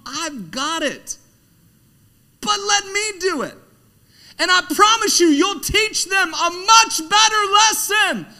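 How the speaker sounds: high and loud, insistent; full-force preaching. Acted. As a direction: drive it home at full volume.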